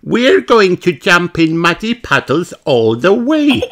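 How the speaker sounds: a bright piglet explaining; Peppa's voice. Acted. Peppa explaining the walk to Granny, high and chirpy. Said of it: "says Peppa".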